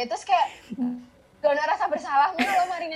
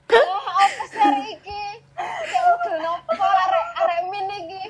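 laughing incredulous quote; 6 semitones higher than usual. Retold or imitated. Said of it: "anjir, kayak".

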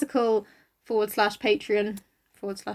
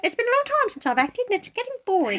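high, wobbly mock New Zealand accent; hammed-up New Zealand accent plug; bad New Zealand accent; 8 semitones higher than usual. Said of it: "in a very bad New Zealand accent".

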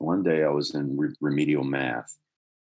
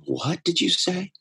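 high, slow, choked-up question; a teacher near tears, hushed and disbelieving; teary disbelief; slower than usual. Retold or imitated. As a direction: ask it through tears, stunned. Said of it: "her eyes watered up".

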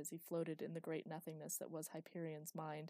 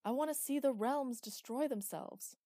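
loud, higher eager call; 7 semitones higher, 7 dB louder than usual. A narrator's reading. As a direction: call out your wish for destruction. Villain calling to Hyperion.

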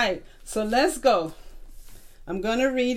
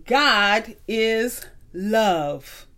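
"God is love" drawn out very slowly; slow reading; 3 semitones lower, much slower than usual. Shown as a demonstration. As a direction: stretch out "God is love" as the key line. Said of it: "And it says this".

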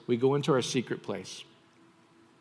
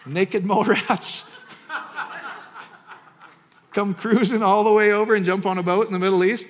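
louder, laughing through the absurd tale; laughing mock disbelief; 8 semitones higher, 9 dB louder than usual. Preacher mocking a skeptic's view of miracles, laughing loud. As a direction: laugh it off as ridiculous.

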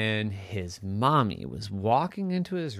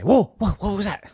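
higher, quick startled reaction; 9 semitones higher, 5 dB louder, faster than usual. Acted. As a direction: act out a mildly spooked visitor. Startled visitors in the story.

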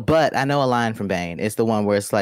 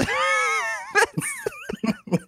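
high shrieking laugh. Real, not acted.

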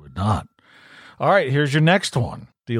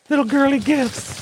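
put-on girly voice; 13 semitones higher than usual. Mocking gift description.

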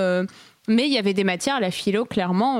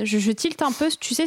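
ordinary talk

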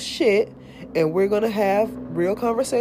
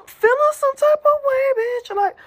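dramatic high squeal; 5 dB louder than usual. Acted. Dramatic outburst about her feelings.

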